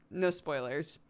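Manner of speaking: usual speaking voice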